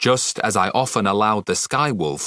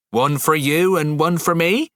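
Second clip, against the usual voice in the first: put-on young girl voice; 7 semitones higher than usual. A narrator's reading. Thorn asks like a daughter.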